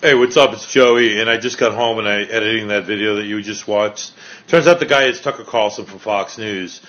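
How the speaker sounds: normal talking voice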